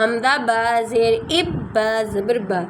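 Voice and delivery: "حمزہ با زے اب با زبا" spelled out slowly, syllable by syllable; slow spelling out. Shown as a demonstration. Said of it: "دو دفعہ کیسے پڑھتے ہیں".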